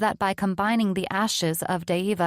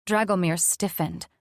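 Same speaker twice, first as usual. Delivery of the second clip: usual speaking voice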